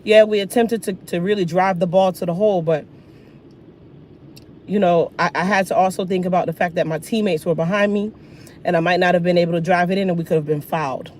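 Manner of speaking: a lightly voiced measured Jordan; calm, articulate press-conference answer; polished athlete interview. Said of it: "He's like".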